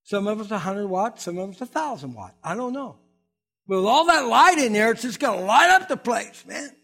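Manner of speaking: rally the church like a preacher; preaching. Acted. Pastor rallying his church for the outreach, preaching.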